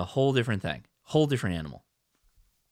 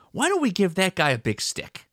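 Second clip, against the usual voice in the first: mock inventor; 8 semitones higher than usual. Voicing sport's inventors.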